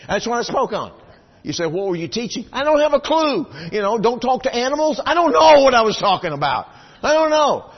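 preacher's loud amused outburst; excited. Real, not acted. Preacher to congregation, loud amused disbelief. Preacher laughing at his odd choice.

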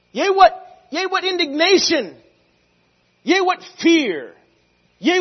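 high, loud, slow proclaiming; fervent scripture reading. Real, not acted. Preacher proclaiming Corinthians to the church, loud and high. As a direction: ring out each "Yea, what". Chanting scripture at full force.

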